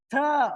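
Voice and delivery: th as an isolated "thuh"; th sound. Shown as a demonstration. Teacher introducing a consonant sound to students, isolated th. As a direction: say th as a sound, not letters.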